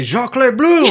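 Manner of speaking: hammed-up French accent on the name; mock French name. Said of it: "he didn't say".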